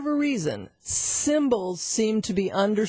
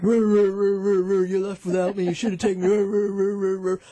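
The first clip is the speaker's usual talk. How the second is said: grumbling dog scolding. Retold or imitated. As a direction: bark out a hurt dog's telling-off. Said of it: "he'd read me the riot act".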